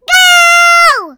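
high, loud starting shout. Acted. Starter shouts the start of the race, high and loud.

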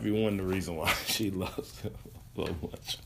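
amused, laugh breaking into the words; trying to talk through a genuine laugh; real laughing. Real, not acted. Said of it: "I shouldn't laugh at that".

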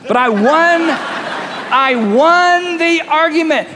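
high, drawn-out hollow boast; hammed-up victory cry; mock triumph. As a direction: crow about winning, stretching WON. Acts hollow boast after she left.

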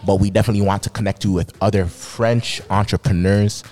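ordinary talk

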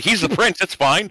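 high, lilting blind trust; hammed-up innocent reassurance; mock naive.

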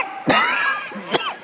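breathy voiceless retch at a bad smell; a hammed-up gag of disgust; mock gag cough. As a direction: cough as if choking on the stink. Imagining toilet stench.

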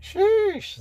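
high, loud sheesh; exclaiming. Retold or imitated. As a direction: blurt out sheesh in amazement. Exclaiming about the drama.

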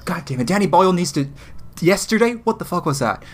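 high-pitched exasperated rant; rant, getting carried away with laughing disbelief; overexcited. Real, not acted. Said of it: "[name] got too excited".

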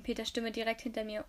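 ordinary delivery, nothing marked

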